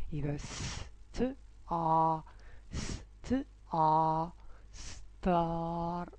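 very slow س ت اه then ستار; blending letter sounds. Shown as a demonstration. Teacher blending star for kids, slow. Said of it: "هنفتح بقنا كده".

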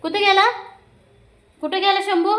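very high coaxing questions; high-pitched talk to an animal; pet talk. Real, not acted. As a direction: ask the pet in a high little voice.

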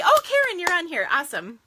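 high, loud burst of delight; excited. Real, not acted. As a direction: shriek her name happily. Spots a viewer's name live.

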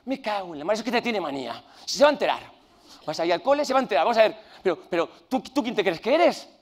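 loud angry dad rant building up; angry father. Retold or imitated. Father storming into school to confront teacher, furious. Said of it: "¿Qué?".

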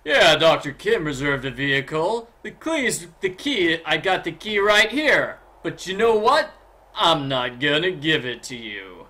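a pushy salesman in a mock New York accent; playful New York accent mimicking the game character; Big Island Mike New York accent. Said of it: "talks with a New York accent".

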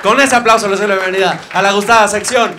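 announcer intro. Acted. Host introducing his comedy segment, booming announcer. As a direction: sell the segment title like a showman.

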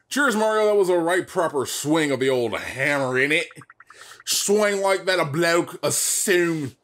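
lightly done cockney-ish British Toad; a bit of a London geezer, half-hearted; mild British bloke accent. Acted. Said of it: "I don't know what accent I'm doing".

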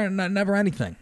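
ordinary delivery

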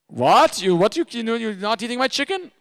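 put-on loud, high German-accented outrage; indignant German chef. German chef offended his chicken is refused, loud German accent. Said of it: "the big German chef".

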